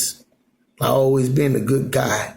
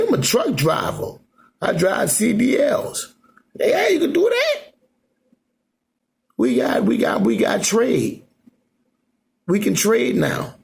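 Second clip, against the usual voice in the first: higher voiced second guy in the chat; truck driver voice; 9 semitones higher than usual. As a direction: raise the pitch to play the other man. Acted chat, second voice answers.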